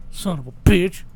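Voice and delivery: normal talking voice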